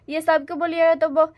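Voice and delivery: ordinary talk, nothing marked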